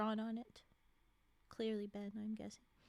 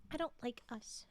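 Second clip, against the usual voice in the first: plain reading voice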